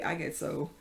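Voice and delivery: ordinary talk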